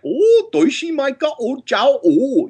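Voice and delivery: high, Goofy-style Japanese gibberish. Retold or imitated. Recalling the Japanese Goofy in his headphones, high Goofy gibberish. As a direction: mimic the Japanese dub of Goofy. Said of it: "the Japanese Goofy".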